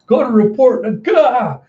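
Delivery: high, loud mock-drunk alarm; hammed-up drunken henchman raising the alarm; drunk goon. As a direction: sound a sozzled alarm, then cry out. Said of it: "you see the drunk Goonies going".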